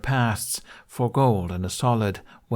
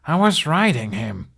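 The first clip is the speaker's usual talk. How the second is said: louder, higher indignant snap; angry snap; 7 semitones higher, 5 dB louder than usual. A narrator's reading. Hiccup snaps at the doubting Viking, higher and louder. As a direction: snap it back at him. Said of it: "Hiccup snapped".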